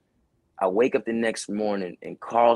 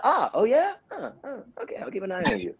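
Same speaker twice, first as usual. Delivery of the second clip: much higher, wary "I'll keep an eye on you"; retelling Carl's suspicious reply to him, high mock doubt; high skeptical manager; 10 semitones higher than usual. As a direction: play Carl doubtful and suspicious. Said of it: "So Carl's just like".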